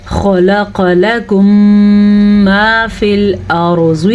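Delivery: خلق with full kha and qaf, short vowels; slow recitation, hum held on لکم into ما; heavy kha. Shown as a demonstration. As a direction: recite خلق thick and unstretched. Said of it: "خوا کو پر پڑھنا ہے".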